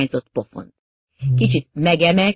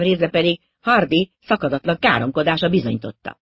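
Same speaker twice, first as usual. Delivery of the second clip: ordinary delivery, nothing marked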